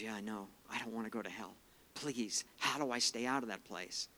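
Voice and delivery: low, hushed retelling of a frightened plea; tearful dying man, retold. Dying neighbor begs the preacher to keep him out of hell, subdued tearful plea. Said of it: "he had tears c".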